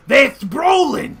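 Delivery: excited shout. Acted. Jimmy shouts to his aunt.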